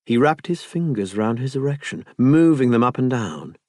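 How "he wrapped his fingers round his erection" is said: usual speaking voice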